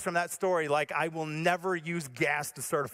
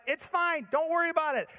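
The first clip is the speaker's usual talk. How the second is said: high, acted brushing-off; mock-confident, pitched-up reassurance; frantic reassurance; 10 semitones higher than usual. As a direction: insist all is well while clearly panicking. Acted panicked reassurance to wife.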